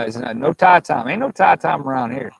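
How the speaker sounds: ordinary talk, nothing marked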